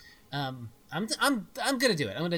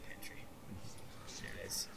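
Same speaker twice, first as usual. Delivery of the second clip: whisper; 10 semitones lower, 19 dB quieter than usual. Acted. Quiet aside in the pantry.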